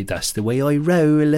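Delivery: put-on Bristol twang; Bristol accent. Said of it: "Steve from Bristol, he'd say".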